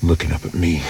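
ordinary talk

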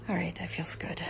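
hushed, aroused whisper; breathy whisper. Real, not acted. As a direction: whisper it, caught up in it. Aroused whisper while being touched.

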